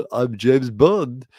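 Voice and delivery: slow, loud mock spy introduction; hammed-up secret agent boast; swaggering James Bond. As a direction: announce yourself grandly, drawn out. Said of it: "goes around everywhere being like".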